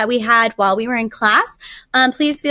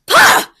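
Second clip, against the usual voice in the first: a sharp high scoffing pah; scornful tiger snort; 8 dB louder than usual. Acted. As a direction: burst in with a contemptuous pah. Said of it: "interrupted the tiger".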